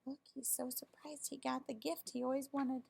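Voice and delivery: usual speaking voice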